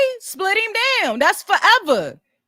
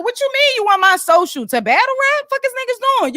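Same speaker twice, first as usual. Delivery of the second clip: higher-pitched mock indignation; indignant retort; 7 semitones higher than usual. Rapper balking at giving out his social, indignant. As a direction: act out an incredulous comeback, pitch climbing.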